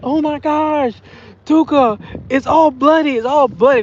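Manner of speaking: high, loud imitation of a panicked child; mock alarm. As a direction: cry out the news like an alarmed kid. Voices alarmed niece bursting in.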